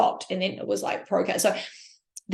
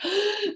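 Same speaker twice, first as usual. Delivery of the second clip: sharp high gasp of awe; excited gasp; 11 semitones higher, 3 dB quieter than usual. Retold or imitated. Gasping while retelling watching Olympia. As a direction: gasp like seeing her on stage. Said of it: "I was like".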